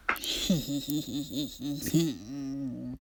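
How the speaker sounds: low breathy gloating laugh; smug chuckle. Acted. Farmer laughs at the bunnies, smug chuckle. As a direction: chuckle at your own cleverness.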